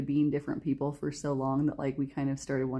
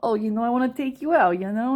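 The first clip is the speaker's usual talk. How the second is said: loud, higher mock New York accent; mock city accent; 5 semitones higher, 6 dB louder than usual. Boyfriend asking her out, hammed-up New York accent. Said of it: "I love the accent".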